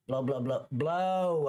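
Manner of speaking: rapid repeats into a drawn-out blow; mock urging. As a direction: egg someone on to blow, stretching the last one. Mimics officer urging breathalyser blow.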